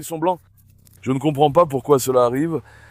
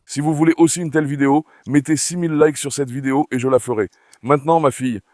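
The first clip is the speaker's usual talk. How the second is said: ordinary talk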